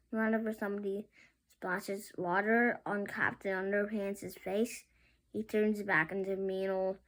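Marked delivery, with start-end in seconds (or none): none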